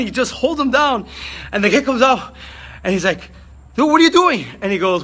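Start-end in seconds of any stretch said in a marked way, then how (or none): 3.7-4.5 high, loud alarmed yell; panicked shout. Retold or imitated. Man pulled underwater yells in panic. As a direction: cry out in alarm as he's dragged under. Man surfacing after being held.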